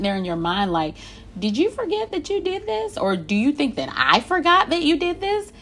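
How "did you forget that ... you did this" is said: high-pitched, incredulous questioning. Acted. Inwardly asking someone if they forgot what they did, incredulous. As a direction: pitch it way up in disbelief. Voicing silent disbelief at offender.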